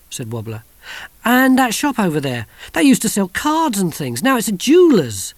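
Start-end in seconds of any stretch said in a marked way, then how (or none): 1.3-5.3 firm, pressing each point home; insistent. Acted. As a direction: insist you're right. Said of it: "said Kirsty insistently".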